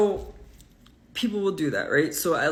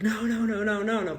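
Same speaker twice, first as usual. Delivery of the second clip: mock panicked boss; 6 semitones higher than usual. Boss trying to stop her.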